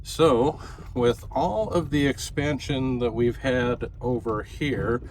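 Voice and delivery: ordinary delivery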